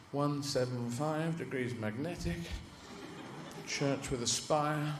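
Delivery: slow, low, measured readout; deliberate navigator reciting landmarks; navigating guide dog. Acted. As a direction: take bearings slowly and softly.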